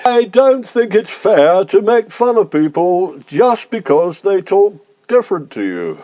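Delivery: slow, posh and deliberate; slow repeat. Acted. Complainant repeating his objection for police, slowly in posh voice. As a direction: repeat it slowly, talking proper. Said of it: "say that again a bit more slowly".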